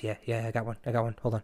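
secretive whispery creep on the phone; hushed creep aside. Retold or imitated. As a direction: plot it quietly like a lurking creep. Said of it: "He goes".

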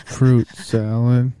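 slow, loud, stretched-out call; loud drawn out. Pretend. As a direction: belt it slowly and stretched.

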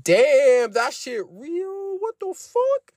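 high, squeaky stunned disbelief; high squeal of disbelief; shocked disbelief. Retold or imitated. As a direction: sound floored that it's real. Shocked realizing warning true.

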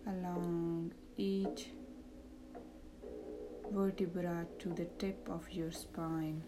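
soft, slow meditation voice; calm, hushed, lead them into relaxation; guided meditation. Acted.

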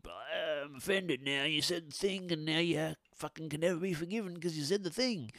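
high, whiny put-on complainer; mocking offended whinger. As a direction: mimic someone theatrically taking offence. Mocks easily offended people.

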